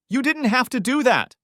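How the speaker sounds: high, loud reproach; shrieking reproach. Acted. Wife reproaching her husband for calling, upset. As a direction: cry out at him in dismay. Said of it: "Michelle said".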